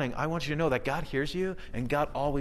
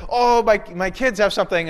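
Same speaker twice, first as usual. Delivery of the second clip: higher, louder fake apology; hammed-up flimsy excuse on the phone; mock excuse; 6 semitones higher, 7 dB louder than usual. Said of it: "be like".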